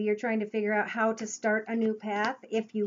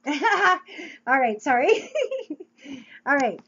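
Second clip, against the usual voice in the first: laughing through the words, louder; breaking up laughing; 4 semitones higher, 6 dB louder, slower than usual. Real, not acted. Laughs at her own joke.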